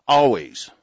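loud punched word; emphatic shout. Real, not acted. Host vowing to listeners, loud emphatic. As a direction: bark it out.

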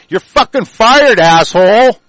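yelling the firing high and loud; furious yell. Real, not acted. Ranting host yelling at patrol chief Jason, furious. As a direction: roar it at him like you're firing him on the spot.

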